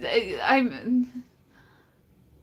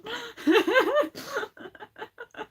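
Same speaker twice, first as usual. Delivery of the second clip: high-pitched laughter; 12 semitones higher than usual. Real, not acted.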